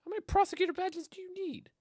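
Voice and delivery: can't believe the pile of badges; incredulous. Real, not acted.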